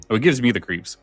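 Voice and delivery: ordinary talk, nothing marked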